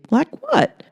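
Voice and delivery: high, squeaky question; nervous, hopeful ask; nervous Earl. Acted.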